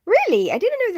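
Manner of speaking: a sharp, loud, high "Really?"; genuinely taken aback; surprised. Real, not acted. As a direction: caught off guard by a comment, she blurts out "Really?" loudly. Reacting to a chat comment.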